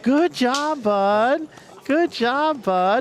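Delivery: put-on high, sing-song praise like to a toddler; talking to a little kid. Big brother praising his brother like a toddler, high and sing-song. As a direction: coo praise at him like he's a small child. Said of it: "Like it's a little kid".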